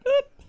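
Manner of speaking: very high squeaky "Boop"; co-host marking a braking point with a boop; playful boop. Acted. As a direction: pop out a cartoon beep.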